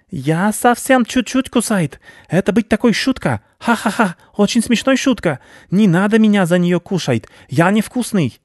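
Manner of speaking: high, frightened rat voice; pleading rat. Acted. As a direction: wriggle out of it with forced joking. Rat pleads not to be eaten.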